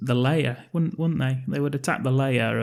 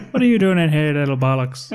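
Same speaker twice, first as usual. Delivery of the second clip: higher, louder Cockney shout; Cockney accent; 3 semitones higher, 4 dB louder than usual. Retold or imitated. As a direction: bark it like a Cockney geezer. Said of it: "He can do a Cockney accent".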